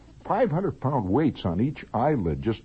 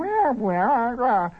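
very high-pitched squawking teacher gibberish; screechy math teacher; slower than usual. Acted. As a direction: drone garbled maths way up above the nose. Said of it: "had a teacher that went".

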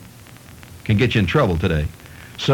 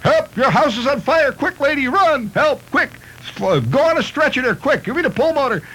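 hammed-up yelling. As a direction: yell at the lady to run. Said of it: "they're screaming and yelling".

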